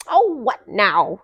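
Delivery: loud, grumbling half-asleep complaint; a grumpy old owl woken too early. Acted. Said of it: "said Owl in a grumpy voice".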